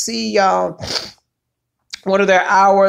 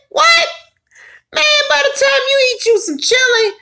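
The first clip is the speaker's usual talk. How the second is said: excited; 12 semitones higher than usual. Real, not acted. Excited about cornbread and chili.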